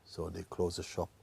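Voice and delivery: ordinary talk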